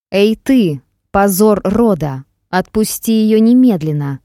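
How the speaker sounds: loud furious roar; roaring shout. Acted. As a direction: bellow it at the enemy. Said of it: "Долетел с земли ответный рык". (громкий яростный рёв)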